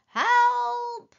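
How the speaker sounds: high cry, slowing as it goes; calling for help. A narrator's reading. Hidden voice claims it fell in the pond, calling for help. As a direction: call out high and stretch the words. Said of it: "called the voice".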